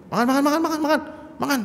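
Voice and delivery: high-pitched, rapid food-pushing repeats; high insistent offer fading to a laugh; pushy Betawi host. Retold or imitated. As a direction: press the food on them like a gruff Betawi host. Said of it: "Nadanya tinggi".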